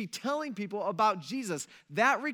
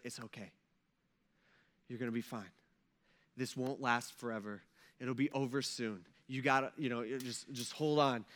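soft comforting mother; 6 semitones lower, 5 dB quieter than usual. Retold or imitated. Mother comforts son before death.

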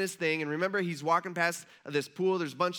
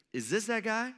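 higher, questioning lift; raised, incredulous question; dawning disbelief; 4 semitones higher than usual. Acted. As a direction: act a crowd member suddenly wondering. Crowd starts to recognize Jesus.

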